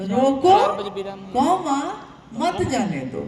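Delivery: रोको, pause, name the comma, pause, then मत जाने दो; dictated comma. Shown as a demonstration. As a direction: dictate it in separate chunks, breaking after रोको. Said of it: "जब लिखिएगा इसको तो". (लिखवाने के अंदाज़ में, रोको के बाद विराम)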